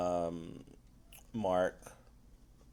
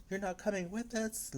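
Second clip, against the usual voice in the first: high, softer retold question; mock dismayed question; 9 semitones higher, 7 dB quieter than usual. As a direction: imitate a friend softly asking in dismay. Voices a character's dismayed question.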